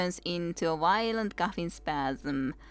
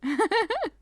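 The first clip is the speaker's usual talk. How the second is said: high cackle; 13 semitones higher, 5 dB louder than usual. Acted. Old woman laughs.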